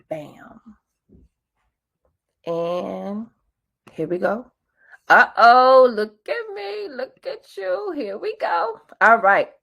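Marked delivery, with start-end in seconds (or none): 5.1-8.8 playful surprise. Real, not acted. Sees herself on screen.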